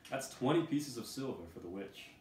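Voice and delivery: head guard calling out a sale; exclaiming guard. Acted. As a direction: announce the price like an auctioneer. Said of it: "The head guard then exclaims".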